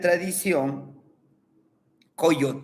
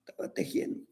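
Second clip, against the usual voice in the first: whisper; 13 dB quieter, much faster than usual. A narrator's reading. Grandfather weaving described.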